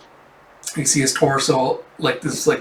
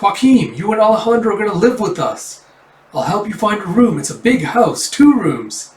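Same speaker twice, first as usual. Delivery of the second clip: high, loud welcoming auntie; a cheery aunt greeting the boys; high put-on woman's voice, eager and welcoming; kind aunt voice; 8 semitones higher, 5 dB louder than usual.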